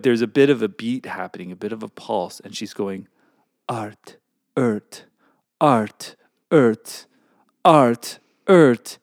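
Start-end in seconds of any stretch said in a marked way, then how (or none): 3.7-9.0 put-on French Canadian accent, building to loud and slow. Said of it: "Your French Canadian accent is appalling".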